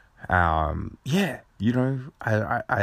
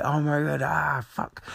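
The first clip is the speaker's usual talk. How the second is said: high-pitched mock dismay; reenacted fluster, pitched up; helpless swearing; 8 semitones higher than usual. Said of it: "I couldn't stop swearing".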